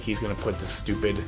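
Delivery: ordinary talk, nothing marked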